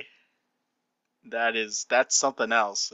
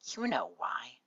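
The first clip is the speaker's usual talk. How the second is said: soft, reluctant character mutter; understated grudging mutter; low mutter; 2 semitones higher, 5 dB quieter, slower than usual. Acted. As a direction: mumble it softly in the pony's voice. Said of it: "a low mutter comes from her".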